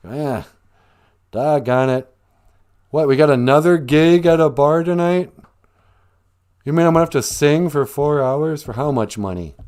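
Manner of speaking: put-upon musician moaning about the job; grumbling complaint. Acted. Musician faced with another bar gig, indignant grumbling.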